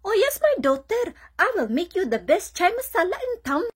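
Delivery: high, lilting eager mother voice; partner's Indian mom. Retold or imitated. Mom happily agreeing to make chai for her daughter, high doting voice. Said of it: "then she would be like".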